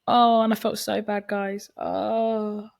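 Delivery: ordinary talk, nothing marked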